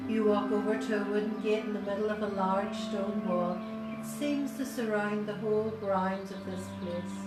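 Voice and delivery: normal talking voice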